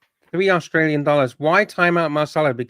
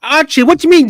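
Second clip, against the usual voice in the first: exasperated outburst; 10 semitones higher, 8 dB louder than usual. Real, not acted. Exasperated, high, loud.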